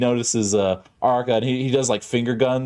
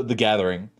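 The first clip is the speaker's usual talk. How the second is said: ordinary talk, nothing marked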